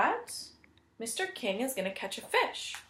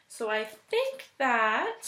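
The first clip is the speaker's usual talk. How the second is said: usual speaking voice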